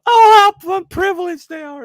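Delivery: very high, loud mocking singsong; mock lament. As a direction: wail it in exaggerated fake pity. Mocking rich royals after remark.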